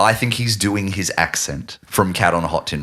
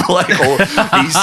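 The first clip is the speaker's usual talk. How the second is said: ordinary delivery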